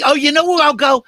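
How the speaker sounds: usual speaking voice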